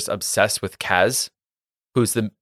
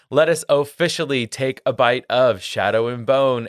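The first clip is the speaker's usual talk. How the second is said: normal talking voice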